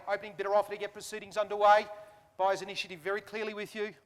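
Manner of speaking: brisk auction patter; auctioneer calling. Real, not acted. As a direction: rattle off bids like an auctioneer.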